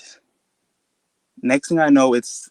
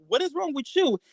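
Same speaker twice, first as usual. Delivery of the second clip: exasperated mom; 5 dB quieter than usual. Retold or imitated. Mom's alarmed retort in story.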